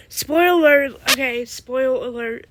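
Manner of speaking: loud warning. Real, not acted.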